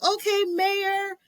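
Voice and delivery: stretched, squeaky okay; drawn out in awe; high slow okay. Retold or imitated. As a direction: starstruck high reply. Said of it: "I'm like".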